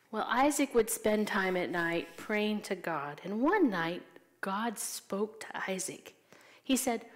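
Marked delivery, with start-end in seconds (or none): 4.4-6.1 soft, slow whisper; hushed whisper. Acted. Teacher tells the children God spoke, in a reverent whisper. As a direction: whisper it like a sacred secret.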